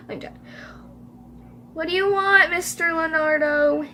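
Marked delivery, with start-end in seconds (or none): 1.7-3.8 raised, louder calling voice; called out. A narrator's reading. Emily questions the stranger through the door, calling out. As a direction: call it through a closed door. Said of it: "Emily called".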